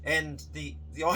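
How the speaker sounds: normal talking voice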